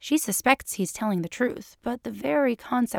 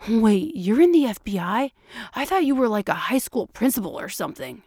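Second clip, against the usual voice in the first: taken aback; 3 semitones higher than usual. A narrator's reading. Quentin learns his friend is in the FBI, surprised. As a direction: blurt it out in amazed disbelief.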